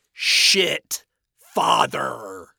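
loud, very slow jab; drawn-out insult. Acted. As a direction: stretch both words out loudly. Insult flung in a quarrel.

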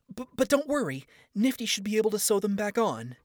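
put-on high, flustered young woman; flustered Charlie. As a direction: stammer it out, high and eager to reassure. Charlie reassures Alastor.

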